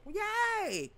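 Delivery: high, bright little yay; mock cheer. Real, not acted. Vlogger cheering the new mail-in rules.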